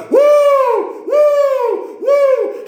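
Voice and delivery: high, loud joyful whoops; James. Retold or imitated. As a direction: whoop repeatedly like an overjoyed worshipper. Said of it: "just going".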